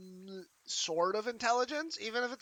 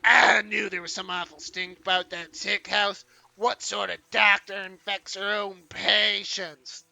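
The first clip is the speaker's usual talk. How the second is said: granny voice; 8 dB louder than usual. Acted.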